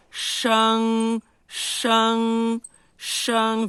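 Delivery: shang mais alto, com o queixo para frente e vogal nasal; nasal sheng. Shown as a demonstration. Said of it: "Viu que meu queixo tá pra frente?". (sheng com vogal nasal, queixo à frente)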